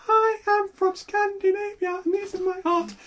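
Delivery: high, slow, arty Scandinavian voice; pompous Scandi art-band impression; Scandinavian accent. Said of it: "I don't think I was doing a very good impression of one".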